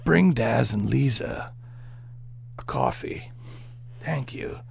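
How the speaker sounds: low, whispery gravel-voiced soldier; Snake voice. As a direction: growl it low like a stealth soldier. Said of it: "You want me to do it in Snake voice?".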